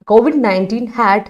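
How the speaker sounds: slow, careful dictation pace; teacher dictating the model answer. Shown as a demonstration. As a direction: read the corrected sentence out slowly. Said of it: "കൊടുക്കുക".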